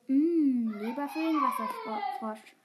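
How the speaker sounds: hammed-up delight over food; savouring. A narrator's reading. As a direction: relish it like a treat. Fritz relishes the liver's smell.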